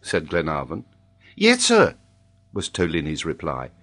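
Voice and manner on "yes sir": put-on high, loud child with accent; Tolini. A narrator's reading. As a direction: pipe up as an accented little boy. Child answers in character voice.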